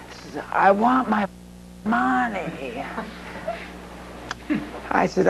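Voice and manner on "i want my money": a bit higher, softer, pressing the demand; repeated threat. Retold or imitated. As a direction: repeat it, unmoved and insistent. Mob boss repeating demand.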